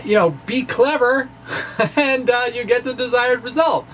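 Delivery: ordinary talk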